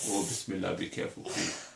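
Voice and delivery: whispered, anxious plea under the breath; hushed worried plea. Real, not acted. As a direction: murmur a nervous warning. Worried the book will fall.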